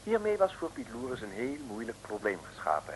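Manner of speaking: ordinary talk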